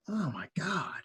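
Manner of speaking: a whispered oh my God, floored; stunned whisper. Retold or imitated. Snubbed young guitarist reacting to his hero, whispered. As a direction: breathe it out in disbelief.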